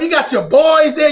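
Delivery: ordinary talk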